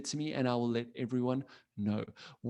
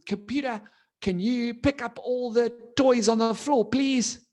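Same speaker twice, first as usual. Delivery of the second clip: higher, building louder to a pleading finish; commanding a computer; 8 semitones higher, 4 dB louder than usual. Pretend. Child asks the computer to tidy up, mock hopeful command. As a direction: call out a hopeful request to a machine. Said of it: "you say to it something like".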